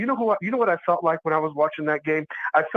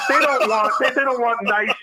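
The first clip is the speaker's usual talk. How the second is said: higher, pushed-up incredulous rant; 7 semitones higher than usual. Real, not acted. Host scoffing at the facility plan, incredulous. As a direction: pitch it up in disbelief.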